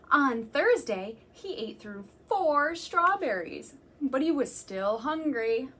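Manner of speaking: slow, emphatic storytime reading; reading to children. Acted. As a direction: savour each food for the little listeners. Reading picture book to children.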